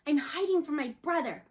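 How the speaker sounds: ordinary delivery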